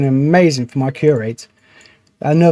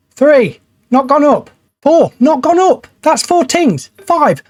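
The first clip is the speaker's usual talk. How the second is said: rising exasperation; 9 semitones higher, 3 dB louder, slower than usual. Real, not acted. Counting failed cures, getting wound up.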